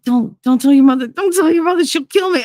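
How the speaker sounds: high, urgent mock pleading; imitated panic. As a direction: plead like a man scared of her mother. Retells his panicked pleading.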